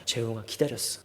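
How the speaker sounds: low, very soft imagined reassurance; gentle divine voice. Retold or imitated. God tells the runaway he has waited, gentle. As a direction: drop right down, tenderly. Voices God's patient words.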